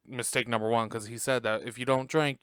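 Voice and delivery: usual speaking voice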